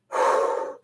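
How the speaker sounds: long audible exhale; demonstrated calming out-breath; slow deep breath. Retold or imitated. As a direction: breathe out slowly to calm yourself. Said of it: "deep breaths".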